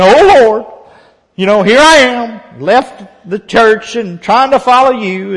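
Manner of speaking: high and loud, pleading; wailing prayer. Retold or imitated. Preacher mocks his own complaint to God, high and loud. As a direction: cry out to the Lord like Elijah. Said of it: "I was like Elijah".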